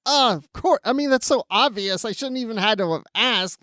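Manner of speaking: mock exasperation. Quoting her reaction to the party's location, mock exasperated. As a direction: raise the pitch as if it's obvious. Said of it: "then she's like".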